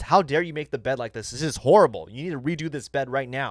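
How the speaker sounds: normal talking voice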